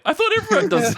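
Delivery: very high, loud squeaky wail; comic falsetto whine; mock innocent protest. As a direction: plead innocence in a squealing voice, laughing. Innocent protest of knocked-out visitor.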